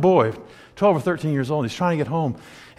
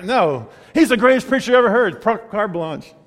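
ordinary talk, nothing marked

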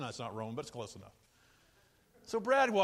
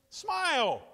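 shouted command; 10 semitones higher, 6 dB louder than usual. Acted. Urging congregation to smile.

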